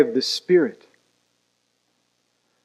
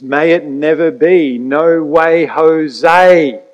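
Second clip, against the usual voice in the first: much louder, rising to a near shout on Jose; emphatic denial; 3 semitones higher, 8 dB louder, slower than usual. Acted. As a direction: hammer the denial home, louder each phrase. Preacher builds the emphatic denial.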